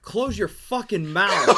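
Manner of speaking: slow, stern drill sergeant order. Retold or imitated. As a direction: lay down the order slowly. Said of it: "drill sergeant scene".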